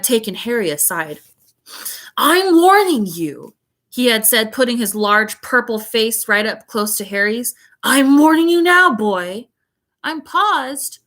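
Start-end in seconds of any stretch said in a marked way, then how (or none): 2.2-3.5 menacing warning. A narrator's reading. Uncle threatening Harry. 7.8-9.5 menacing warning. A narrator's reading. Uncle threatening Harry.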